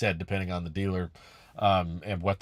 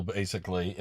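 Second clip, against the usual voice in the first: ordinary talk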